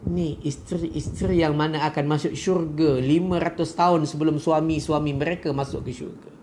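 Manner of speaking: usual speaking voice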